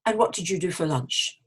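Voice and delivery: "what did you do for lunch" going down at the end; falling question. Shown as a demonstration. Asking a W H question, voice falling. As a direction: let "lunch" drop. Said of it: "usually your voice goes down".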